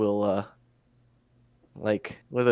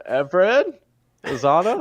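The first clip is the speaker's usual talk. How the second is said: higher, louder calls up through a hole; calling out; 9 semitones higher, 5 dB louder than usual. Acted. Marco calling party members through the floor, loud calls. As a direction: hail distant friends by name. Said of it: "I'll just call out my party members' names".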